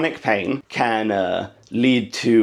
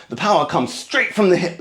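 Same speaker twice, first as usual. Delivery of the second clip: usual speaking voice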